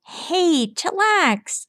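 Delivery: high teasing tomboy voice; cool it, playfully; Rainbow Dash, teasing. A narrator's reading.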